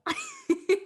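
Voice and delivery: high-pitched burst of laughter. Real, not acted. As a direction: crack up at the greeting. Bursts out at deadpan greeting.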